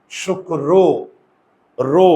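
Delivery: শুক্র as "shuk-kro", then "ro" repeated; pronunciation demo. Shown as a demonstration. Teacher asks how we pronounce it, শুক্র sounded out as "shuk-kro". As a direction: double the k in শুক্র and round the last o. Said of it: "এটাকে উচ্চারণ করি কি?".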